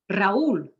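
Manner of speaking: "Raúl" in two syllables, ra-OOL; strong U. Shown as a demonstration. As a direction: punch the U in "Raúl", not blended with the A. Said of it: "the U is strong".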